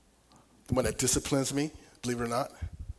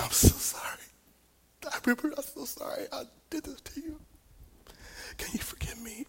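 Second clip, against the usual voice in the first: high, thin retold crying; tearful apology; 12 semitones higher, 4 dB quieter than usual. Father asks his son's forgiveness after an outburst, tearful high apology. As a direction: plead through imitated tears, voice breaking upward. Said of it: "and I'm crying".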